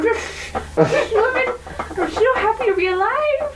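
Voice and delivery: high, lisping little fish voice, laughing. Pretend. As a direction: squeak it out like a hooked fish with a lisp. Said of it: "your impersonation of a fish with a lisp".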